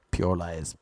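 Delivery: ordinary talk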